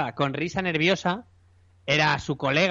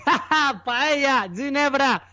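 drunk mockery; 6 semitones higher, much slower than usual. Mocking the drunk American.

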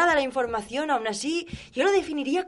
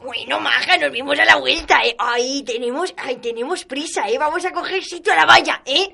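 put-on gossipy old lady; 5 semitones higher, 7 dB louder than usual. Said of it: "son Florinda y Natividad".

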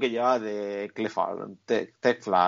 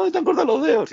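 mock worried hero; 14 semitones higher, 4 dB louder, faster than usual. Mocking the other character again.

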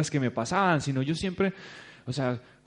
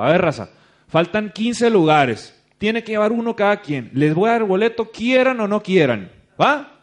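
loud, forceful ultimatum, climbing higher to the end; aggressive, retold; 5 semitones higher, 7 dB louder than usual. Organiser pushing his group to fill fifteen seats, aggressively. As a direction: lay down the law, punching each demand. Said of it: "Uy, qué agresivo".

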